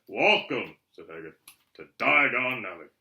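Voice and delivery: grand welcome. A narrator's reading. Hagrid grand announcement.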